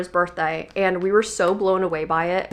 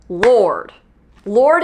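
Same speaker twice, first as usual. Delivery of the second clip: emphatic reveal; 6 dB louder than usual. Real, not acted. Telling viewers who sat beside her, emphatic.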